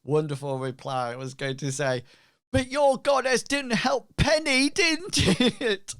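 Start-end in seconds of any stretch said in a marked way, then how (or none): 2.5-5.9 high, loud, incredulous heckle; mock heckler. Heckler needling a cleric about the goddess, high mock protest. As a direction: pitch it way up and challenge them.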